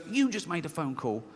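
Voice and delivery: level, reasoning rebuttal; rational inner voice. Acted. Rational side corrects the fearful one, plainly dismissive. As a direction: answer in an ordinary voice, unimpressed. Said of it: "This one goes".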